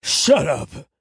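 loud growled command from a dad; forceful, furious bark; angry growl. A narrator's reading. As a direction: growl it harshly to silence him. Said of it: "his dad growled".

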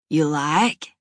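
lightly voiced Southern drawl, lazy and slow; a hint of a Southern cowboy drawl. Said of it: "Rodeo drawls in a lazy southern accent".

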